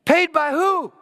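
high, loud disbelieving question; can't believe the debt is gone; the woman asking who paid her bill; surprised. Retold or imitated. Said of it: "she looked at me and said".

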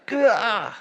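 sharp high cry of frustration; a sudden high exasperated yelp; exasperated gah. Real, not acted. Frustrated outburst mid sermon.

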